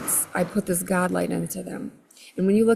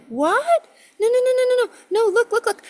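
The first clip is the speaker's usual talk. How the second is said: much higher and louder, panicked refusal; high frantic protest; 6 dB louder, faster than usual. Retold or imitated. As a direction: plead in a squealing panic. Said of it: "And I'm like".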